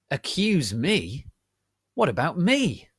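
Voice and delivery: growled. A narrator's reading. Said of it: "I growled".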